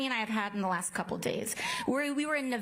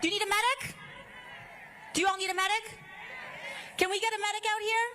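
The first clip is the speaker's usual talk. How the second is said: man down shout; 7 semitones higher, much faster than usual. Real, not acted. Crowd member collapses, calls medic.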